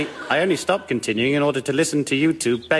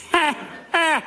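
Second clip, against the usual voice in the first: evil cackle; 13 semitones higher than usual. Acted. Witch gloats over memory.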